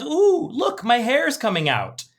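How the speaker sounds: high-pitched "ooh" of dismay, read lightly; mildly upset wife. Acted. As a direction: act a touch of alarmed discovery, starting high. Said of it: "And she looks very upset".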